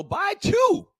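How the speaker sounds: high shouted "Buy two"; [name]'s catchphrase. Retold or imitated. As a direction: belt it out like the hype man. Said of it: "as the great [name] says".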